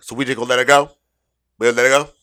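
loud mock-indignant question; indignant challenge. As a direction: throw it back loudly, pitch rising at the end. Retold indignant customer challenges manager.

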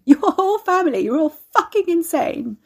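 high, loud, slowed hysterical outburst; cracking up in disbelief; hysterical laughter. A narrator's reading. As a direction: shake with laughter while accusing them. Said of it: "shoulders shaking".

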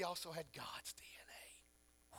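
whispered slowly, low and soft; stage whisper. Real, not acted. Preacher whispering a revelation to the congregation. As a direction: breathe it like a secret.

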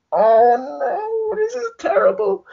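high whiny mock wail; fake-cry it up high; mock despair. Narrator despairing at the drunk scene, mock crying wail. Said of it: "And I was like".

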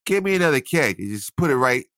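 high, lazy call to honey; lazy husband calling. Pretend. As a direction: call out from the couch for another keg. Imagined request to partner.

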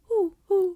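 high hooting owl call. Pretend. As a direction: hoot like an owl. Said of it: "Do an owl".

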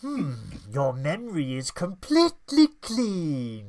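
creepy mind-reader taunt; Psycho Mantis voice. Pretend. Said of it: "Psycho Mantis impression".

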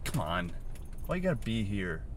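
soft, weary mock complaint; understated exasperation; annoyed. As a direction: grumble quietly at an unwelcome bug. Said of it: "now I just get annoyed".

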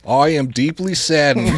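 slow, loud presidential impression; JFK. Reader starts the funeral quote as a joke, JFK impression. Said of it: "That's my J F K".

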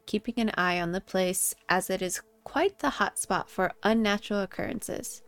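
spooky story narration. Acted. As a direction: read it like a campfire tale. Reads her scary story.

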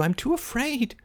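ordinary delivery, nothing marked

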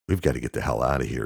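fast, urgent warning. Acted. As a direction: rush it out, shaken. Luke scared, fleeing.

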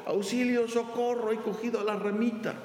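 high, frantic pleading calls; desperate cry for help. Acted. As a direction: cry out desperately from the cliff edge. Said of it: "empezó a gritar desesperadamente".

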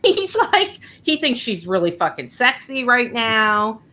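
usual speaking voice

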